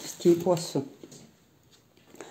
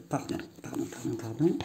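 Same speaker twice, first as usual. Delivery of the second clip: whispered apology; 5 semitones lower, 8 dB quieter than usual. Real, not acted. Apologizing to someone passing by.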